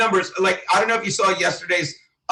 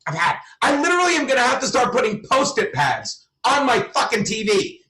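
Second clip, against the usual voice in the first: normal talking voice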